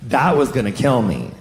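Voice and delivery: normal talking voice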